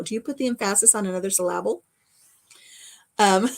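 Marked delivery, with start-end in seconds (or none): 0.5-1.8 emphasis as em-FASS-is, syllable as sil-LAB-ul; stress on wrong syllables. Shown as a demonstration. Asking Major about another pronunciation, em-FASS-is and sil-LAB-ul. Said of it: "put the emphasis on the wrong syllable".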